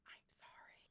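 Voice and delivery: soft unvoiced whisper; barely-there whisper to something small. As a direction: whisper a tiny, hushed apology. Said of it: "she's gonna whisper to them".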